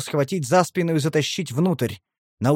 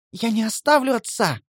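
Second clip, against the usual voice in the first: high, anguished protest; son's impassioned, raised-pitch outcry; crying out; 8 semitones higher than usual. A narrator's reading. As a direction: exclaim in desperate refusal. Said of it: "вскричал Бер".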